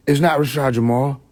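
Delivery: ordinary talk, nothing marked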